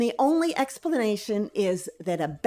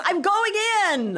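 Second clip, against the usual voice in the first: high mock battle cry; hammed-up heroic yell, drawn high; retold yell; 10 semitones higher, 3 dB louder than usual. Husband charging in after the bat, mock heroic yell. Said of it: "And he yells".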